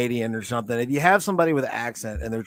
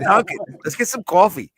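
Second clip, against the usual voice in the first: put-on high, louder, laughing Boston accent; hammed-up Dunkin' ad Boston voice; mock Boston accent; 5 semitones higher, 4 dB louder, faster than usual. Parodying a Boston Dunkin' commercial, laughing in a mock Boston accent. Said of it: "one of those Ben Affleck, Dunkin' commercials".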